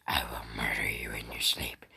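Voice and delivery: a low, sinister whisper of a threat; menacing whisper. Pretend. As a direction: whisper it like a creepy villain. Cat's imagined menacing threat.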